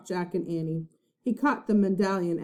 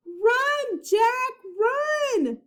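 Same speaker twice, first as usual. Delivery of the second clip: high urgent shout; cried out; much slower than usual. A narrator's reading. Annie crying to Jack as pirates charge, high shout. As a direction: scream at him to flee. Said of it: "cried Annie".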